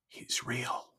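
hushed, breaking near-whisper; imitated tearful awe. Bawling lady realising God is real, tearful hush. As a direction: imitate someone overcome, barely voicing it. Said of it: "and she's bawling".

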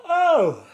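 a big high yawn breaking into the talk. Real, not acted. Podcaster yawning mid-ramble, a real high yawn. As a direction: let a real yawn take over the word. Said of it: "fucking yawn".

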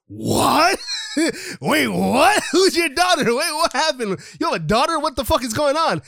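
mock everyone gasping at the news; shocked disbelief. Crowd stunned by the secret daughter, high shocked what.

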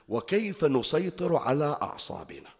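usual speaking voice

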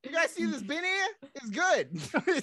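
high, hammed-up Brooklyn patter; playful, high put-on Brooklyn voice; Brooklyn accent. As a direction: pitch it up as a cartoon Brooklynite. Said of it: "Our accent is as good as".

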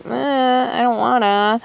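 put-on a reluctant little kid pouting; lightly voiced whiny kid; whiny inner child. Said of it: "my inner child that's like".

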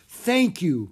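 relieved cry. A narrator's reading. Manager thanks the children for helping, crying out. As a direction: cry out gratefully. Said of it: "cried Mister Dewey".

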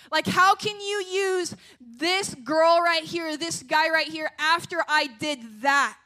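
slow, loud, anguished plea to God; pleading prayer. Acted. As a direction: beg God slowly, full of doubt. Voicing believers pleading with God.

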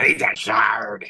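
slow breathy pirate whisper. Acted. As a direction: hiss it like a pirate. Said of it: "Inner pirate, naked pirate voice".

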